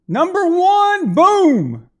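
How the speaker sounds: very high, loud drawn-out game show call; triumphant announcement. Pretend. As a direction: belt out the winning roll. Announces winning roll like game show.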